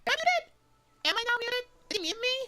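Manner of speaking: high, loud flustered panic; suddenly alarmed that the mic is dead; panicked surprise. Real, not acted. Streamer suddenly thinks mic is muted.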